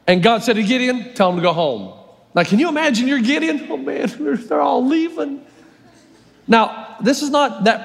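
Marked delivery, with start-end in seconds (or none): 1.1-1.9 lower, calm command; voice of God. Acted. God telling Gideon to send them home, lower. 3.7-5.4 higher, laughing dismay; dismayed Gideon. Acted. Gideon watching his army leave, laughing in dismay.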